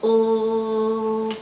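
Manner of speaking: "ooh" as a long held L sound; letter L sound. Shown as a demonstration. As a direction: hold "ooh" as a humming L. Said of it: "the sound that L says is".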